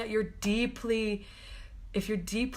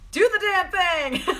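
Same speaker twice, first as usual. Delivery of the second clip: higher and louder, laughing through it; 10 semitones higher, 7 dB louder than usual. Real, not acted. Cheering viewers to do the damn thing, laughing. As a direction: toss it out with a laugh.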